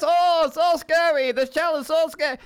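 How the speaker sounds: high, loud Boston whine; Boston accent. Retold or imitated. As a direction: squeal it in a hammy Boston accent. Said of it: "almost as good as Anissa's Boston accent".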